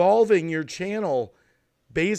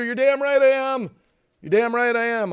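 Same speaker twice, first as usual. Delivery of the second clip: higher and louder, defiant; 7 semitones higher, 4 dB louder than usual. Real, not acted. Streamer answering his critic, defiant and loud. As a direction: throw it straight at them.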